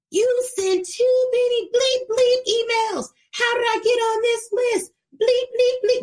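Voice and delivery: high, monotone mock rant with spoken bleeps; imitated cursing-out. Mailing list subscriber cursing out the coach by email, mock rant. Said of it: "cursing me out".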